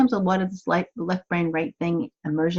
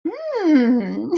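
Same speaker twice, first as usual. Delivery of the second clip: curious hmm; 5 semitones higher than usual. Shown as a demonstration. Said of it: "And we go".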